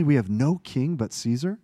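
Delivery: disbelieving repeat ending in a rising question; incredulous echo. Real, not acted.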